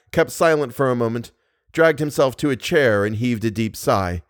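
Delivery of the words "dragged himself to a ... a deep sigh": ordinary delivery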